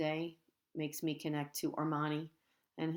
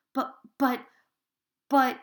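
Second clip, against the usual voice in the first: high, loud stammering buts; flustered protest; 8 semitones higher, 10 dB louder, slower than usual. A narrator's reading. Girl protesting the teacher's answer, high and loud. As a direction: splutter in protest.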